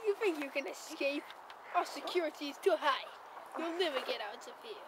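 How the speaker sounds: menacing villain. Acted. Villain taunting prisoner.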